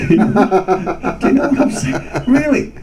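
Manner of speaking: high, laughing insistence. Real, not acted. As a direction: laugh through the words.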